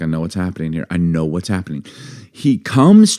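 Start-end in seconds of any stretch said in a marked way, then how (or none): 0.0-1.8 low, soft knowing mutter to himself; quietly, like you saw it coming. Retold or imitated. Driver muttering softly as car ahead slows. Inner thought about slowing driver.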